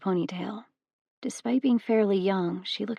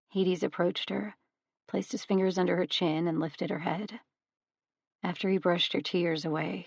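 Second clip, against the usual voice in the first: plain reading voice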